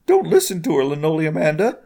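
high, earnest character voice; Bilbert. A narrator's reading. Friend tells her to ignore Sabrina, high-voiced.

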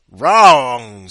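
very loud, drawn-out bellow; screamed verdict. Acted. Bow-tie man rejecting contestant two's answer, screaming. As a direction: yell it like a ruthless quizmaster. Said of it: "screamed the bow tie".

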